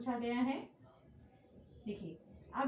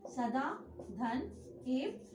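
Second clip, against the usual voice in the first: slow breakdown; much slower than usual. Shown as a demonstration. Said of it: "तो सदैव का क्या बनेगा?".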